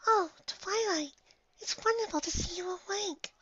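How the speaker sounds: a shy pony barely daring to speak; gentle whispery pony; timid Fluttershy. Acted. Fluttershy's soft voice.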